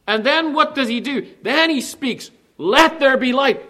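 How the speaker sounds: preaching at full force. Acted. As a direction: build it like a pulpit climax, ending on a loud proclamation.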